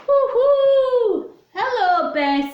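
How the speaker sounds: high, loud hooting hello; owl calling. Acted. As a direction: swoop in with a big owl hoot. Owl voiced in story.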